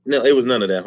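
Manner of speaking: normal talking voice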